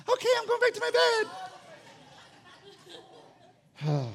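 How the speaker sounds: very high mock little-girl voice; suddenly happy daughter. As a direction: chirp it like a child instantly recovered. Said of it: "she's like".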